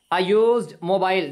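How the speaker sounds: used said very slowly as yoozd, opening with a y glide; slow model phrase. Shown as a demonstration. Teacher reading a used mobile slowly to the class. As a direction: stretch out a used mobile word by word. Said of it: "नीचे आके देखिए".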